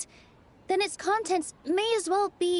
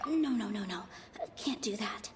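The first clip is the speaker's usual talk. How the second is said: breathy whispered self-correction; under-the-breath refusal; 7 semitones lower, 8 dB quieter than usual. Acted. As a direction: whisper it to yourself. Inner thought rejecting a dialogue option.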